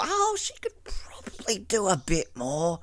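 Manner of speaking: soft unvoiced sweet whisper; whispered mock Nicole. Imitating little Nicole whispering her gentle sass. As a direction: breathe it like a polite secret. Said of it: "little Nicole".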